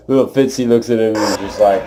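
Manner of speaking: usual speaking voice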